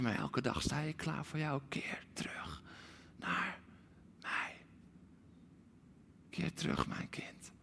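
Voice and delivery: whispered, breathless plea. Acted. God whispering to his child to return. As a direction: whisper it close to their ear. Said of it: "Want God zegt".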